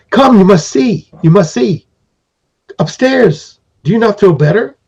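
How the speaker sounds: high, eager little being beckoning; lightly voiced eager goblin calling you along; excited little creature. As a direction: urge them upstairs like an excited sprite. Little being urges you upstairs.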